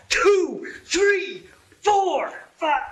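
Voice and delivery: rising, climbing pitch count; excited counting. Acted. Counting off surrounding Indians.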